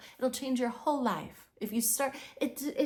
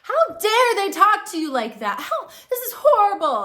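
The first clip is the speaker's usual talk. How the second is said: put-on high, loud outraged little girl; a child in the passenger seat, loud and high with outrage; indignant child; 9 semitones higher, 11 dB louder than usual. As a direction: squeal it like an upset eight-year-old. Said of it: "and I was like".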